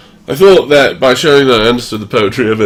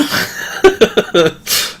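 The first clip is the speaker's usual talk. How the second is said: high, breathy run of laughter; giggling burst; real laugh; 6 semitones higher than usual. Real, not acted. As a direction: laugh at your own joke. Laughs at own joke.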